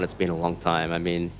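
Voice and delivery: ordinary talk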